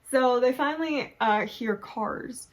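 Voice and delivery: normal talking voice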